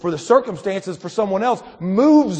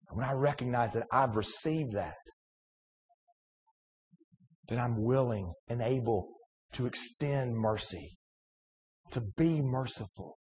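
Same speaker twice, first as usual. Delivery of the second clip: quiet preaching; 6 semitones lower, 11 dB quieter than usual. Acted. Soft close after climax.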